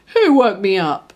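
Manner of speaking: loud, grumpy just-woken voice; a rudely woken creature complains; sleepy grumble. A narrator's reading. Ball-creature woken by a puppy, grumpy and loud. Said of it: "It opened its sleepy eyes".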